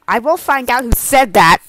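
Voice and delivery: loud menacing vow; a hammed-up loud threat. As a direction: promise revenge, loud and firm. Threat to the heckler.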